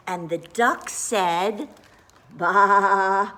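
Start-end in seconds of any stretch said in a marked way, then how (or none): 2.3-3.3 a loud, comic baa; loud sheep bleat. A narrator's reading. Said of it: "as loud as he could".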